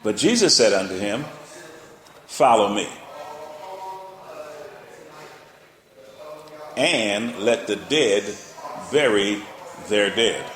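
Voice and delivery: slow, emphatic verse reading; scripture reading. Acted. Preacher quoting Jesus to the church, slow and firm. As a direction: lay the words down slow and firm.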